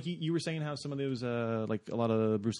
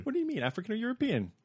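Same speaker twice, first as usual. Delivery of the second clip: Monty Python quote voice; 10 semitones higher than usual. Retold or imitated.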